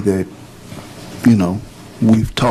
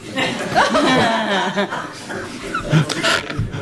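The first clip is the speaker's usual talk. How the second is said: a high, soft burst of laughter at the joke; amused laugh; 12 semitones higher, 5 dB quieter than usual. Real, not acted. Commissioner laughing at a colleague's allergy joke, high soft laugh.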